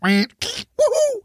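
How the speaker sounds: very high falling woohoo; a silly high celebratory whoop; cartoon whoop sliding down; science noise woohoo. Shown as a demonstration. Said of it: "So I have to do noises like".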